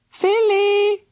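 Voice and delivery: high, loud call. A narrator's reading. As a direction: call a name from far off. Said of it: "called Missus Love".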